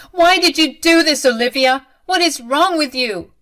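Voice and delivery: loud, high-pitched angry confrontation; upset outburst. A narrator's reading. Bride confronting her future mother-in-law, upset and loud. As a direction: demand an explanation, loudly.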